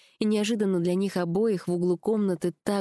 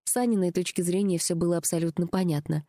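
ordinary delivery, nothing marked

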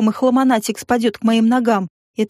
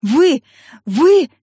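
gasped, higher-pitched angry stabs; breathless outrage; 4 semitones higher, 4 dB louder than usual. A narrator's reading. Woman outraged after forced kiss, breathless. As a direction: choke out each word separately. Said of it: "задыхаясь, процедила я".

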